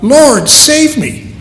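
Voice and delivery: high, slow plea, voice lifted in fear; panicked cry for help. Acted. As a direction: cry it out like a man sinking in the sea. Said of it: "in a panic, cries out".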